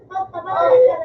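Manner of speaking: loud cry for help; yelling help me; shouting for help. Real, not acted. Calling for help in game.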